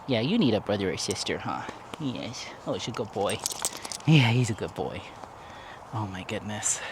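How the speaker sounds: soft pet talk. Real, not acted. Owner cuddling her dog, hushed doting praise. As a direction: praise the dog gently and quietly.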